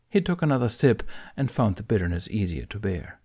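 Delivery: ordinary delivery, nothing marked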